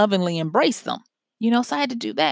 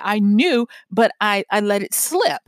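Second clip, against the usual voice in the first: ordinary delivery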